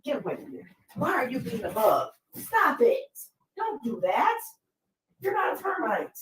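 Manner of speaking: scolding the dog chewing the floor; talking to the dog. Real, not acted. Shooing the dog off wood.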